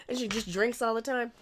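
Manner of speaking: ordinary talk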